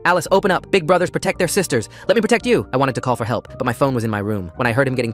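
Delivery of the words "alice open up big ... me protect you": high, loud, rising desperate plea. Acted. Brother pounding on bathroom door, loud desperate plea. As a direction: beg through the door, climbing. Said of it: "his tone turned desperate".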